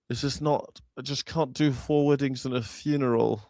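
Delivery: softly reluctant English actor; Hugh Grant. Acted. Comic imitating an English actor declining a film, soft English voice. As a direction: decline quietly in an English accent. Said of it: "Hugh Grant's mouth always looked like".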